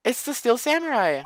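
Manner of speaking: put-on high frantic girl. Maya tells Nick the Samurai was arrested, high panic.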